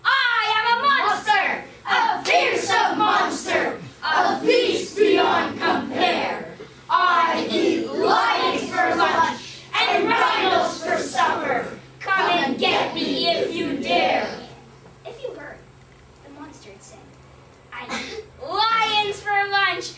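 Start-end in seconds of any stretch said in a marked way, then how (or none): 0.1-14.3 slow, very loud and higher roaring monster chant; the monster roars its chant at Leopard, slow shouted boast; fearsome monster shout. Acted. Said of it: "the voice rumbled back". 17.7-19.9 slow, loud, higher monster growl; the monster threat repeated, loud and slow; monster boast. Acted. Quoting the monster again.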